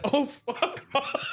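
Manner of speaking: slow high laughing "oh fuck off"; a hint of a mock dismissal; mock brush-off. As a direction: drawl it out through a laugh. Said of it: "lip read John Dizrak and said".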